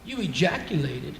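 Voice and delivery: low, soft, matter-of-fact doctor; very calm. Retold or imitated. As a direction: imitate a doctor calmly breaking news. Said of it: "said to me very calmly".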